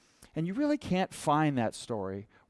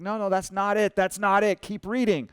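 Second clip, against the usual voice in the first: mildly exasperated brother, higher pitch; raised-pitch retold frustration; frustrated; 5 semitones higher, 4 dB louder than usual. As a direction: push back with growing impatience. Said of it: "He was getting frustrated with me now".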